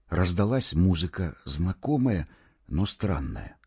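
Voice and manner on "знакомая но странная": usual speaking voice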